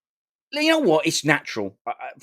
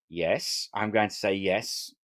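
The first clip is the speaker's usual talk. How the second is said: ordinary delivery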